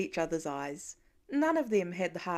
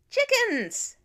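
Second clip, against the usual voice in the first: put-on much higher, louder child's cry; startled little boy; 13 semitones higher, 7 dB louder than usual. A narrator's reading. Small boy suddenly remembering the chickens, high alarmed cry. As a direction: blurt it like a panicked little kid.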